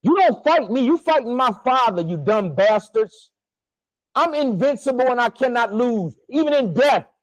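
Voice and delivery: defiant. Real, not acted. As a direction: throw it at them, daring them to try. Defiant warning to unseen enemies.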